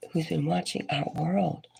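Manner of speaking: normal talking voice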